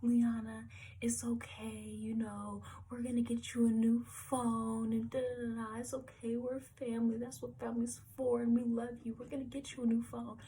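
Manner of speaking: high, tipsy, gushing cousin voice; drunk cousin. Retold or imitated. Tipsy cousin comforting crying Liana in the car, high gushing voice. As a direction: play the drunk cousin promising a new phone. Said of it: "she's drunk".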